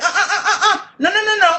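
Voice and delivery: high-pitched rapid protest, re-enacted; loud objection. Mother interrupting an apology from the back, high loud objection. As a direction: cut in high with a string of noes. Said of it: "and I'm loud".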